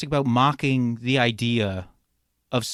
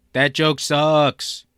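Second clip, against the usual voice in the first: ordinary talk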